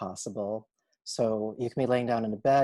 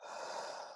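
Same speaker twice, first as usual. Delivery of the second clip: soft, toneless sigh out; soft demonstrated sigh; audible exhale; 14 dB quieter than usual. Shown as a demonstration. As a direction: let the breath go quietly through the mouth. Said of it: "exhaling out of your mouth".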